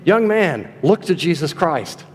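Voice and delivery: ordinary talk, nothing marked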